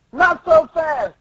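high, slow battle call; challenging Tontada. Acted. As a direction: halt them, then call the attack. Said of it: "And then Tontada's like".

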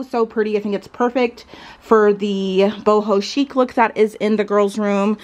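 ordinary talk, nothing marked

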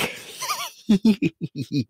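high, loud clown giggle; shrieky little clown laugh; gleeful cackle. Acted. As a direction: burst into high creepy giggles. Clown amused by deadly photo.

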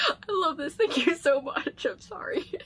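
tearful laughing thanks, rising to a whispered squeak; overcome, voice giving out at the end; crying with happiness. Real, not acted. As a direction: thank her through real happy tears. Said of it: "sniffling 'cause I was crying".

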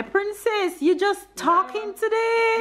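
high, slow cooing; baby talk. Acted.

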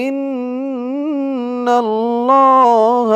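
slow, melodic recitation of the verse; Quran recitation. Shown as a demonstration. Teacher reciting the verse for students to repeat. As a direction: chant it slowly and clearly. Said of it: "আয়াতের শুরু থেকে আমার সাথে বলুন".

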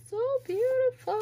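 very high-pitched, delighted coo; swooning over something beautiful, way up high; awed delight. Real, not acted. Gushing over layered embellishments.

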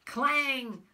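loud high metallic clang sound. Acted. Bread biting on a hidden frying pan, loud clang. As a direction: ring it out like iron struck.

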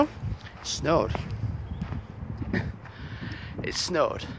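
soft amused reveal under the breath; deadpan, then laugh it off; quiet chuckle at the surprise snow; wry quiet laugh. Real, not acted.

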